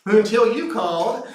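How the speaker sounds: high, laughing mock answer; mock reply. Answering the dawn caller, high and laughing. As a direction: play the groggy reply high and giggling.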